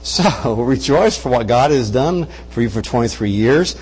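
ordinary talk

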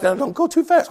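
soft, high imitation of a wife; bride's worried voice. Bride warning her husband before the ride, imitated high and soft.

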